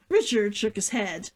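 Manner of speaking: ordinary delivery, nothing marked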